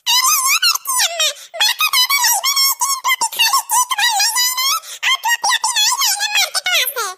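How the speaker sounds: put-on child voice. Young boy pleading with his elder aunt about the party cap, high childish voice. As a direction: talk like a little kid asking for a cap.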